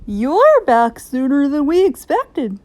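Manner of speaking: a touch of a surprised principal; school principal. A narrator's reading. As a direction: greet a teacher who is back early. Principal voiced in story.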